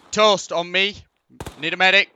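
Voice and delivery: loud urgent call over gunfire; shouting for a medic. Real, not acted. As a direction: yell it out, wounded and urgent. Wounded player calling for help.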